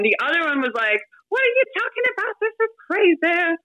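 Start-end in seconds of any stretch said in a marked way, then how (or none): 1.3-3.6 high, fast, indignant outburst; high, fast, exasperated agent quote; mad agent. Retold or imitated. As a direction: imitate the angry agent, pitched up high. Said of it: "He was so mad".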